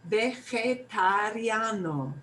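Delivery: Vegetariano slowly, "beh-kheh-tah-RYAH-no"; slowed way down. Shown as a demonstration. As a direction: stretch Vegetariano out for learners. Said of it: "we're gonna slow it way down".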